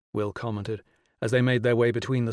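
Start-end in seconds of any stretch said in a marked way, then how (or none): none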